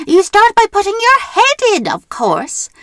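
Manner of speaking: loud high exasperated witch; frustrated witch. Acted. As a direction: snap it like a fed-up witch. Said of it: "The witch was frustrated".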